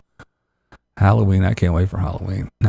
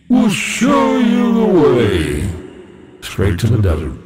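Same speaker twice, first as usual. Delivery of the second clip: prophet voice; slower than usual. Pretend. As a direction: intone it like a prophet. Mock prophet voice.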